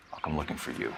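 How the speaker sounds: ささやき声で低く脅す; menacing whisper. Acted. Quiet threat to a colleague.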